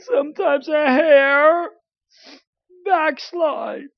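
slow, lightly tearful pulpit appeal; mock crying pastor. Crying pastor pleading with his church, slow mock-tearful preaching. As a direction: imitate a preacher drawing it out, near tears. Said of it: "The pastor's crying".

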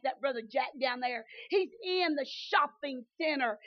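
shouted, high, fervent sermon delivery with climbing cries; full-force preaching. Acted. Preacher tells the congregation God boasts in them, shouting. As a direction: preach it to the rafters.

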